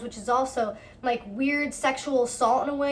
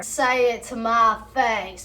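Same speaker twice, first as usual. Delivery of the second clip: slow, exaggerated imitation of a challenge; mock him, stretching each word; mocked tough-guy threat; 3 dB louder, slower than usual. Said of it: "yelling insults through the door".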